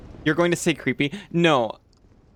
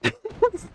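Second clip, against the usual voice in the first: high giggle. Real, not acted. Laugh after outburst.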